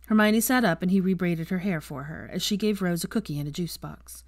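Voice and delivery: ordinary delivery, nothing marked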